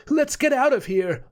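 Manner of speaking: high, tearful plea; a frightened brother blubbering, pitched high. A narrator's reading. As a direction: beg to flee through tears. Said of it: "blubbered Jarkos".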